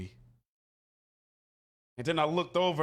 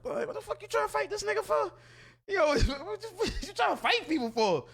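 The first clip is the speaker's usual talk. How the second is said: much higher, fast, laughing disbelief; mock the friend's amused scolding; friend's incredulous voice; 11 semitones higher, faster than usual. Dunway asking why he fought, amused high disbelief. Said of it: "He like".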